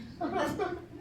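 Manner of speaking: short amused chuckle; amused laugh. Real, not acted. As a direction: laugh at the boast. Narrator laughs at Arachne's boast.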